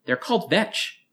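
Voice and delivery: put-on high, bright little girl correcting; piping up to help; helpful chime-in. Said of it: "Aaron chimed in helpfully".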